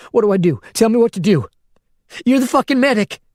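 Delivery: high and loud, near hysteria; panicked woman begging the medic what to do. A narrator's reading. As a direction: beg him for orders in a panic. Panicking over dying man.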